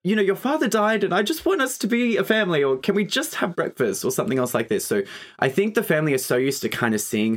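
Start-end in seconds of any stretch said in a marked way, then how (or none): 0.0-4.0 high pleading mom voice; a much higher, pleading mum; imitating Ruth. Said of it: "She's like".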